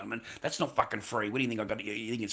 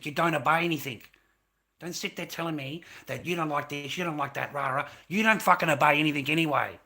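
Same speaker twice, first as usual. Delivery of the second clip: builds from firm to a full shout; rising into a furious yell; angry retort; 2 semitones higher, 8 dB louder than usual. Retold or imitated. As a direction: hurl it back at them, louder each line. Retold heated argument, building.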